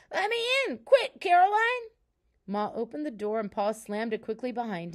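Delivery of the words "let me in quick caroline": high, loud cry to be let in; urgent shout. A narrator's reading. Pa to Caroline, pounding on the door, shouting. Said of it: "and Pa shouted".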